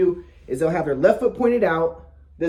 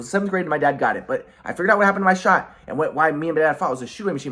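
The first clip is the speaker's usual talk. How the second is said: usual speaking voice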